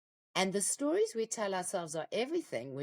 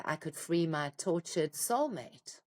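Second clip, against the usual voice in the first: ordinary talk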